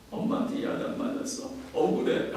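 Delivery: unvoiced, sniffly little-girl complaint; a hint of a tearful daughter to her dad; whiny teary child. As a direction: whimper like a scolded daughter. Voicing crying daughter.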